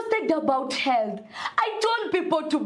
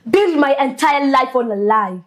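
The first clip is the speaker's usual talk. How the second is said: louder, bitter and steady; angry declaration; 4 dB louder than usual. Acted. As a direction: throw it at her mother. Daughter's final angry speech.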